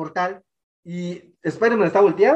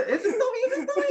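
very high, soft squealed imitation; squeaky mock whisper of a fan spotting someone; excited audience member; 4 dB quieter than usual. Imitating people spotting Toby in the cinema, excited squeal. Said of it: "Yo escuchaba gente que decía".

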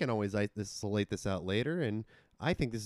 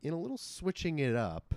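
ordinary talk

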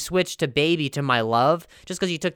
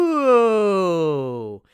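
teasing ooh; 5 semitones higher, 7 dB louder than usual. Real, not acted. Scammer bites back with fans.

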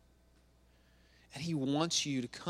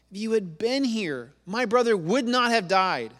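loud, high accusing lament; angry reproach; 7 semitones higher, 8 dB louder than usual. Acted. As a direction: hurl the blame, punching the words. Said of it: "Mary is so angry with Jesus".

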